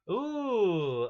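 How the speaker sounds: loud, high surprised ooh; a big surprised ooh. Real, not acted. Reacting to a hit.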